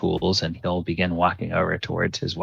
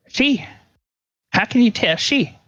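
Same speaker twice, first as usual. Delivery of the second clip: much higher, baffled country husband; surprised farmer; 14 semitones higher than usual. Acted. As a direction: squeak out his confusion.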